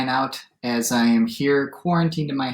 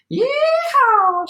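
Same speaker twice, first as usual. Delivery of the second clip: very high, loud yeehaw whoop; cowboy shout; 6 dB louder than usual. A narrator's reading. Gingerbread cowboy escapes the rancher, high whooping shout. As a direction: let out a high rodeo whoop. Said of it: "shouted the gingerbread cowboy".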